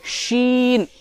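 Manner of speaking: शीन with a long hushing sh; clear sheen. Shown as a demonstration. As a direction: hush the sh of शीन. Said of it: "तो ये कैसे निकालेंगे?".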